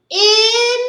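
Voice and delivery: "in" with the short i, high and loud; short i sound. Shown as a demonstration. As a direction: isolate the short vowel in "in". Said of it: "do you hear".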